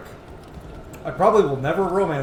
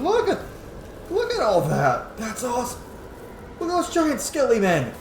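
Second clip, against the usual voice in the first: higher-pitched awed excitement; excited; 9 semitones higher than usual. Real, not acted. Streamer marvelling at the giant skeletons in a game, excited. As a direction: gush in amazement, voice jumping up.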